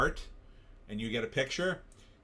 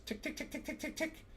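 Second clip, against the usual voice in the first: ticking watch imitation; 10 dB quieter than usual. Shown as a demonstration.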